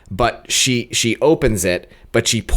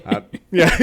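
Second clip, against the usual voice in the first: laughing; 8 semitones higher than usual. Real, not acted. Laughs at friend's joke.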